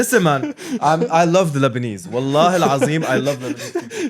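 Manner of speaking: high, loud Lebanese bro; Lebanese accent. Pretend. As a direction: play the loud Lebanese guy. Continues Lebanese bro impression.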